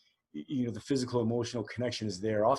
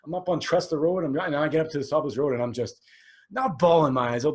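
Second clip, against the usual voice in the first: ordinary talk, nothing marked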